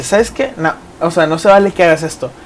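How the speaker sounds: ordinary talk, nothing marked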